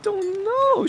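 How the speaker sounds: put-on very high, whimpering child voice; helpless little-kid wail; tearful little girl. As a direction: answer as a weepy lost five-year-old.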